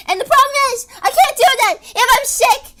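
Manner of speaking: laughing shout. Real, not acted. Laughing rant about being sick.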